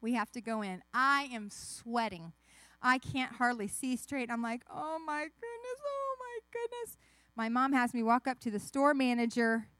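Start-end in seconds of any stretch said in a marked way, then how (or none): 4.7-7.0 soft, high-pitched dread; panicky fretting. Acted. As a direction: fret under your breath in a high little voice. Child panicking before facing manager.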